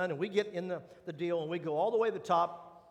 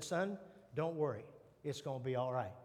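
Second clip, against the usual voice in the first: softer, low and calm; reassuring dad; 6 semitones lower, 7 dB quieter than usual. Retold or imitated. Dad comforting his scared son at the top, softly. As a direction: settle a frightened son gently.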